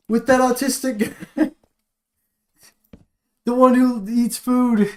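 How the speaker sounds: high loud excited yelling; excited shouting. Real, not acted. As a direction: keep the loud excited yell going.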